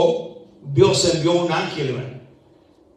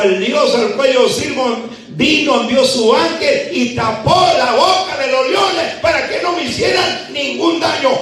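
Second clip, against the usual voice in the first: shouted, much higher sermon climax; fiery preaching; 9 semitones higher, 4 dB louder than usual. Acted. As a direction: preach it out to the whole church. Preaching at full force.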